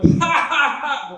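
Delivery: high, loud, forced ha-ha laugh; hammed-up staccato laughing; mock laughter. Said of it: "I'm like".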